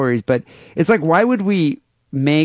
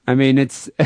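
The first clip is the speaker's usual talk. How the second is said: ordinary talk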